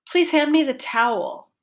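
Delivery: towel high, vowel long and clear; focus on towel. Shown as a demonstration. Teacher repeating focus word towel for students. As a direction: stretch towel with a rise-fall. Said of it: "Pitch went up and down".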